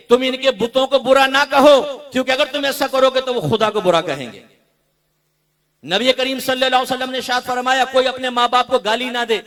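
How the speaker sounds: loud, sustained declamation of scripture; emphatic preaching. Real, not acted. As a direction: proclaim the command to the congregation. (बुलंद आवाज़ में was)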